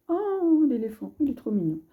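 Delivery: high squeal of delight on Oh, then quick; gushing over a cute card. Real, not acted. Reader finding the elephant card, cooing delightedly.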